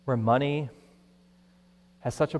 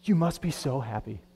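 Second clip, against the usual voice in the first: gushing envy; 6 semitones higher, 11 dB louder than usual. Retold or imitated. Poorer person gushing at a rich one: building envious excitement. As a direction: pile up the envy until it bursts. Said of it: "you went to them and said".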